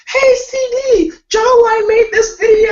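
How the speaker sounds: very high, loud, slow call-out; high-pitched loud fan call; fans cheering. Retold or imitated. Quoting fans calling out.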